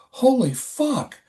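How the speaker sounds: high, slow stunned oath; awed curse. Retold or imitated. Narrator reacts to the photos, slow and stunned. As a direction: draw it out in awe. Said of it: "And I go".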